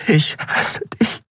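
slow whisper breaking into crying; tearful whisper. A narrator's reading. Andriel repeats it before weeping, tearful whisper. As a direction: whisper it slowly, on the edge of tears. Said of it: "Dann begann sie zu weinen".

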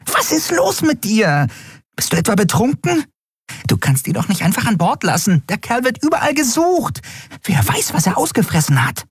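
high-pitched, agitated dressing-down; alarmed scolding. A narrator's reading. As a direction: scold your friend in shrill alarm. Nelen scolds her friend in alarm. (aufgebrachtes, hohes Schimpfen)

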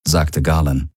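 plain reading voice

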